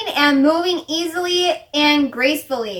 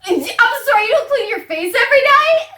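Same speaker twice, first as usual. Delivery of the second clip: high-pitched incredulous question; appalled disbelief; 7 semitones higher than usual. Real, not acted. Friend hears about skipped face washing, appalled disbelief. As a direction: challenge your friend, scandalised.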